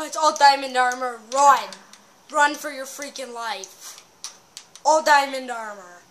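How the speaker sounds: loud alarmed shouts at the enemy; panicked yelling. Real, not acted. Player spotting armored enemies, shouting in panic. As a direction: yell the warning, run now.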